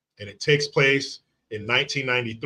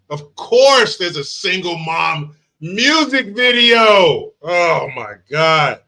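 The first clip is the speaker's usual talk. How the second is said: drawn-out, exasperated groan of disgust, higher and louder; 6 semitones higher, 8 dB louder, slower than usual. Real, not acted. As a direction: stretch it out like you can't believe it. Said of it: "I'm just disgusted".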